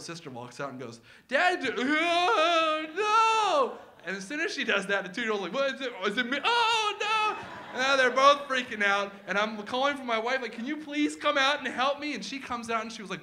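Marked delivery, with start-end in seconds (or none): 1.3-3.8 very high, loud, drawn-out cry of alarm; panicked girl. Retold or imitated. Big sister seeing the bloody toddler, high shrieked panic. 5.5-7.4 high, loud panicky questions building to a shriek; freaked-out toddler. Retold or imitated. Two-year-old catching her sister's panic, high loud shriek. 10.5-12.1 high, loud call for help. Retold or imitated. Dad calling his wife outside, loud and high.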